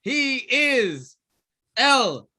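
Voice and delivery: slow, drawn-out wrestling announcer; ring announcer. Acted. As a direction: stretch out the name for the crowd.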